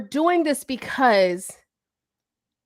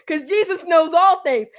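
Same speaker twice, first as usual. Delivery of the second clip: ordinary delivery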